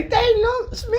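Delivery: high wailing sob; crying. Retold or imitated. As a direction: whimper it high. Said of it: "He'd say".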